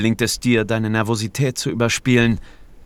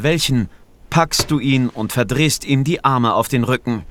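plain reading voice

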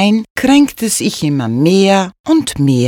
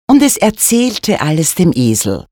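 usual speaking voice

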